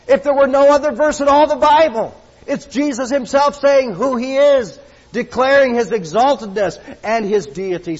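high, loud preaching; fiery preaching. Acted. Preacher stirring up the congregation, loud and high. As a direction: preach it out to the back row.

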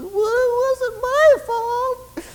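very high, whiny mock crying; whimpering Adam. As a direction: whimper the excuse in a squeaky wail. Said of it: "starts to whimper and he says".

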